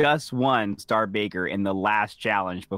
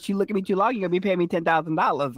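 higher-pitched, quick retold threat; mock warning; 7 semitones higher, faster than usual. Woman warns someone looking too long, higher-pitched mock threat. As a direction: imitate her naming her price.

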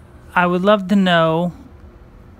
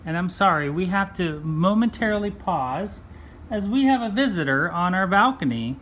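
usual speaking voice

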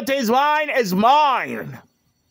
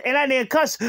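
ordinary delivery

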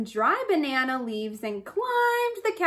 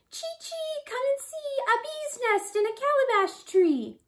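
high chirping bird voice, much higher; bird call; 13 semitones higher than usual. A narrator's reading. Honey guide calls Rabbit to the bee tree, high and chirpy.

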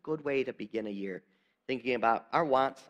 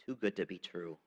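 softer and lower, letting it sink in; hushed aside; 7 semitones lower, 9 dB quieter than usual. Shown as a demonstration. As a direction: drop the voice on "Too good to be true.". Preacher repeats it more softly.

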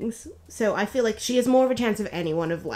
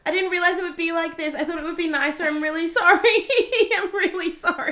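higher and louder, through laughter; laughing; 8 semitones higher, 6 dB louder, faster than usual. Real, not acted. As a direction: apologise while laughing. Friend laughing at her ordeal.

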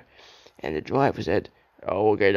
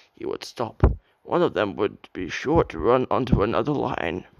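usual speaking voice

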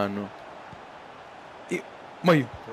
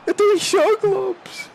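shocked; 13 semitones higher, slower than usual. Real, not acted. Gamer shocked at the game.

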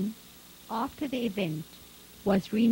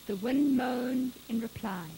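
plain reading voice

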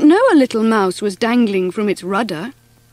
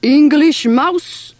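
slow, slightly raised French-mouse address; sighing; 4 semitones higher, 3 dB louder, slower than usual. A narrator's reading. French brother addressing the English mouse, sighing. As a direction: draw it out as Big Pierre. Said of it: "sighed Big Pierre".

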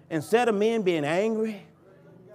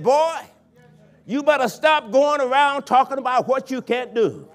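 put-on high, loud scolding of a boy; scolding mother figure; 6 semitones higher, 6 dB louder than usual. Sister Laverne scolding the young preacher, loud and firm. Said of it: "that very woman said".

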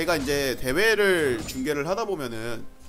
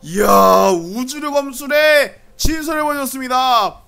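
excited; 5 semitones higher, 9 dB louder, slower than usual. Real, not acted. Caster hails pentakill.

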